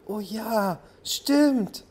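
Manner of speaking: high, happy squeal rising at the end; delighted. A narrator's reading. Little cloud realizes it rained, squealing with delight. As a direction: burst out with joy. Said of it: "freute sich die kleine Wolke".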